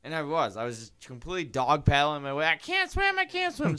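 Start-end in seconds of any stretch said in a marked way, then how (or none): 2.5-3.8 very high squeal at talking volume; mock screaming. Dog-paddling child headed for the ladder, mock scream. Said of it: "Screaming like a little bitch".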